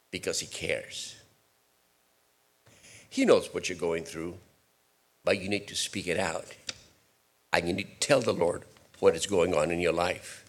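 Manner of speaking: hushed caring counsel; tender pastoral. Real, not acted. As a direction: gently coax them to speak.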